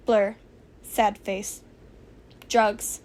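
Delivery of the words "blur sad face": ordinary delivery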